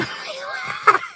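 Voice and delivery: high, wheezy laughing fit; helpless laughter. Real, not acted. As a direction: laugh until the breath runs out. Laughing fit at packaging text.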